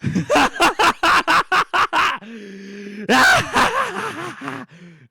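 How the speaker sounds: long, high, explosive laughing fit; loud manic cackle. Real, not acted. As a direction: burst into booming, high laughter. Outburst after yelled order.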